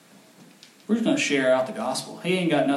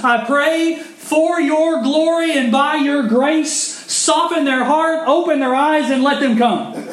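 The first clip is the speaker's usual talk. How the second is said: much higher and louder, slow pleading; fervent prayer; 12 semitones higher, 7 dB louder than usual. Acted. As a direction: plead to God with full fervour. Prayer building to fervour.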